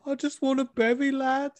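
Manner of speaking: very high Scouse impression; imitated Scouse accent. Crying winner answering the interviewer, mock Scouse accent. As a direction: mimic her high-pitched Scouse lilt. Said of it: "And she's like".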